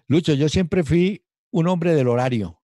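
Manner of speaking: firm, deliberate statement to Lucho; emphatic. Real, not acted. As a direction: insist proudly on punctuality. Emphatic claim to host.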